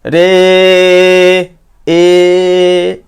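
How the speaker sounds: ए held long and loud, stretched as a pluta vowel; prolonged vowel. Shown as a demonstration. Teacher demonstrating a three-beat drawn-out ए. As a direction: draw out ए for three beats, calling high. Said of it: "तीन बार करते हैं".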